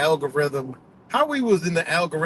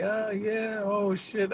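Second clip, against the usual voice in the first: high, softer giddy reaction; imitated giddiness; 7 semitones higher, 5 dB quieter, much slower than usual. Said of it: "giddy and shit".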